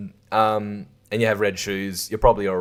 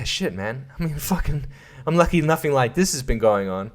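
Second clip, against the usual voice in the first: ordinary delivery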